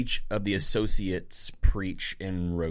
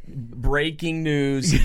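slow, louder news-anchor announcement; newscaster voice; 4 semitones higher, 4 dB louder, much slower than usual. Pretend.

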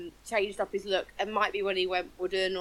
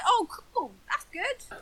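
mock casual shrug; 9 semitones higher, slower than usual. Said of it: "and he's like".

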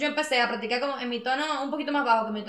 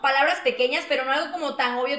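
ordinary talk, nothing marked

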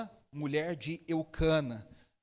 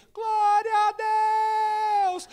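very high, loud, long-drawn praise cry; mock church holler on a held high note; imitated congregant's shout; 14 semitones higher, 9 dB louder, much slower than usual. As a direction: belt it out slowly like an oblivious churchgoer. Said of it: "uma pessoa desavisada no meio da igreja falou assim".

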